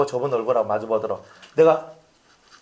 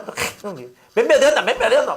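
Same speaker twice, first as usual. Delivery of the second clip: playful doting; 8 semitones higher, 4 dB louder than usual. Retold or imitated. Pastor playfully coos to a child. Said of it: "이렇게 하면서".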